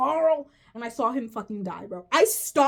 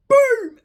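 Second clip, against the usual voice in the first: mock gunshot boom; 13 semitones higher, 14 dB louder than usual. Imitating the gunshot.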